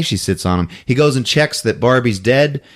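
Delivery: ordinary talk, nothing marked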